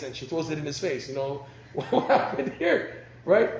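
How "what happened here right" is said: shocked husband. Retold or imitated. Husband shocked by wife's outburst.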